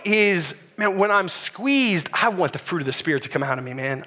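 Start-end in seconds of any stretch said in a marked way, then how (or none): none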